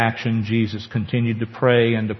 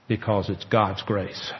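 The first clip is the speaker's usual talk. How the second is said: ordinary delivery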